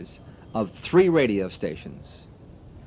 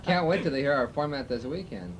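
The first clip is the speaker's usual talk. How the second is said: normal talking voice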